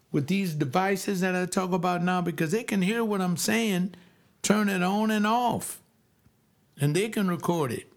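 ordinary talk, nothing marked

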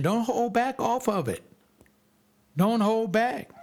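high, driving preacher's exhortation; preaching. Acted. Preacher urging parents not to hold back, high and driving. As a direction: preach it hard to the congregation.

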